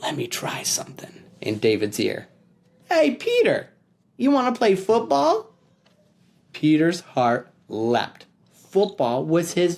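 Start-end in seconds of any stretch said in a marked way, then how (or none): 0.0-1.2 soft unvoiced whisper. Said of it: "leaned in close and whispered". 2.9-5.5 high, bright bait; tempting offer. Acted. Frankie tempting Peter.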